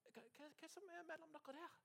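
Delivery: soft, high-pitched wondering; curious discovery. Acted. Storyteller spots something between the children, hushed curiosity. Acts noticing the hidden box.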